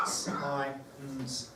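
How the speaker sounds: "Science" slowly split into separate sounds. Shown as a demonstration. Teacher counting four sounds, "Science" segmented. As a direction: sound out each part of "Science". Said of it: "it's got four sounds".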